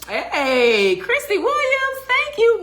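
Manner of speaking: high, loud gushing thank-you; overjoyed shout-out; excited thanks. Real, not acted. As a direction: squeal thanks to the donor. Thanking a donor on stream.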